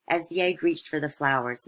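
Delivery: plain reading voice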